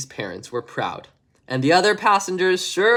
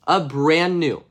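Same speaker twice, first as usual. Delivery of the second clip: ordinary delivery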